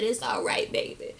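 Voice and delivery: soft whispered comfort; whispered soothing. Retold or imitated. Grandma soothing Amber in a whisper. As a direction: hush her like a grandma.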